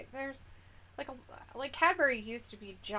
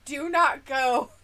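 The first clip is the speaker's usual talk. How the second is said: slow emphatic warning; 7 dB louder, much slower than usual. Acted. Warning chat off bad snack.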